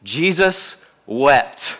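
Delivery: Jesus wept drawn out very slowly; slow, weighty reading. Shown as a demonstration. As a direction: let each word land with weight.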